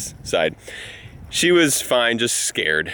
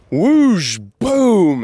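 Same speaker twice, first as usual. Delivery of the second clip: louder, higher mouth sound effects of a crash; whoosh boom effect; 7 semitones higher, 5 dB louder than usual. Retold or imitated. Dad acts out go-kart rolling off the cable, loud sound effects. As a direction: make the crash noises with gusto. Acting out go-kart tipping over.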